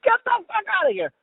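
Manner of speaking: can't believe it, squeal it out; stunned disbelief. Retold or imitated. Disbelief at celebrity living nearby.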